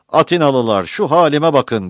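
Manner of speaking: loud, high-pitched call to a crowd; crying out. A narrator's reading. Wounded Pizistrat to the Athenians, crying out. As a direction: cry out like a wounded orator. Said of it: "diye haykırdı".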